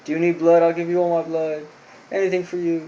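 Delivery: hammed-up lovesick suitor fussing; love-struck gushing. Love-struck boy rushes to his injured crush, mock gushing. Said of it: "him being all love-struck".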